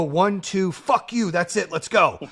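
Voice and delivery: loud, punchy count-off, all in; aggressive charge. Retold or imitated. Host acting out his all-in attitude, loud and punchy. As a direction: fire yourself up and charge. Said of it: "that's just how I go".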